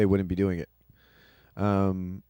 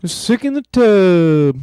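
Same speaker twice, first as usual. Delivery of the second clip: dramatic wail; 11 semitones higher, 12 dB louder, slower than usual. Pretend. Mocking friend's sick complaint.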